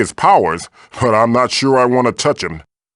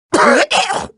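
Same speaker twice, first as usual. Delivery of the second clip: hairball cough. Acted. Hacks up a hairball.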